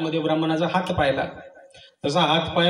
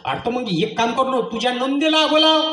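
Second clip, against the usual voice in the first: high-voiced neighbour; 7 semitones higher than usual. Neighbour advising.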